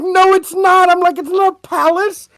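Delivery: high, loud comic contradiction; exasperated protest. Retold or imitated. Retelling his denial to the coworker, exasperated. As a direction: squeal it in disbelief.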